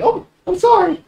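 put-on high girlish falsetto; flustered high-pitched sorry; apologetic girl. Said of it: "She's like".

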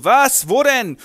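high, loud shocked cry. Acted. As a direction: jolt up in surprise. Count shocked.

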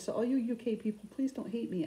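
ordinary talk

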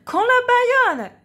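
high, loud cartoon cry; shrieking saxophone; saxophone's shout. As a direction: yell like a startled saxophone. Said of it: "crie le saxophone".

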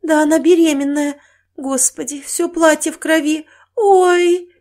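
high, loud tearful horror; distraught cry breaking into a wail; sobbing. Acted. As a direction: wail in shock, building to a sob. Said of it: "всхлипнула Мария Петровна".

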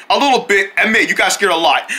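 ordinary talk

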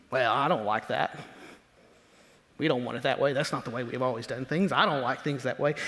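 low, soft, sulky complaining voice; a grumbler muttering that things are not done his way; grumbling complainer. Retold or imitated. Preacher imitating a church grumbler, low and sulky. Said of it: "You've heard it. You've probably done it".

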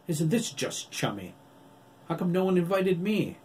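cocky jeer at the reunion; sarcastic taunt. Acted. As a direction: needle them with fake hurt. Jake mocks the group.

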